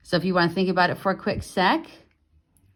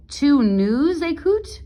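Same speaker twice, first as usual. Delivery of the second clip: higher-pitched mock parent asking; 7 semitones higher, slower than usual. Parents asking their kid if he listens, mock impatient. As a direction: voice a parent checking you are listening. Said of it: "Imagine your parents saying this to you".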